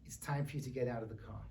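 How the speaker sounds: low and soft, quietly menacing; scary dad voice. Acted. Daddy orders his daughter out of the car, scary. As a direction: play an ominous father, voice dropped. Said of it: "he says in this scary voice".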